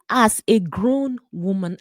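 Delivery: usual speaking voice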